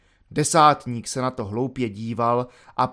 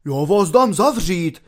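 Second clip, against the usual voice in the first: loud, higher desperate shout; desperate cry; 3 semitones higher, 5 dB louder than usual. Acted. Beaten corporal threatens the volunteer with arrest, loud desperate shout. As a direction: yell it in helpless rage. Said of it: "vykřikl zoufale desátník".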